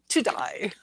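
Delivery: Cockney "Today" said as to-DIE; mock Cockney vowel, amused; Cockney accent. Shown as a demonstration. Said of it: "mine's more Cockney".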